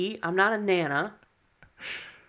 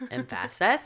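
"Emphasis" with the middle syllable stressed, em-FASS-is; wrong-syllable stress; slower than usual. Shown as a demonstration. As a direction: lean hard on the FAS in "Emphasis". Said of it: "Emphasis on the wrong syllable".

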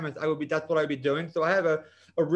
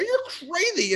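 incredulous lady; 14 semitones higher, 3 dB louder, slower than usual. Retold or imitated. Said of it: "And she's like".

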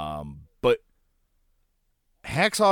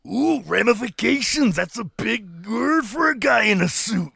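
mock Macho Man; 7 semitones higher than usual. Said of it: "Macho Man mocking the suit".

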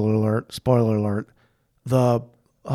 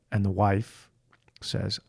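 usual speaking voice

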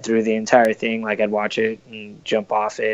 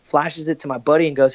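ordinary delivery

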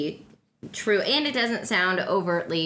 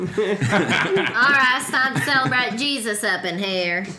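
louder, hammed-up Texan holler; Texan accent; 4 semitones higher, 6 dB louder, slower than usual. Texan gatecrashing a Druid celebration, loud Texan accent. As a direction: announce it as a rowdy mock Texan. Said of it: "Texan just walks in with a shotgun".

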